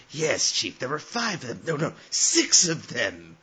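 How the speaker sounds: lightly voiced flustered underling muttering; hint of a bumbling henchman talking to himself; imitated muttering. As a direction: mutter a report to the chief, then correct yourself. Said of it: "he's just muttering himself like".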